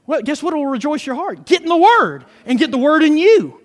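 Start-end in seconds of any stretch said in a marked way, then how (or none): none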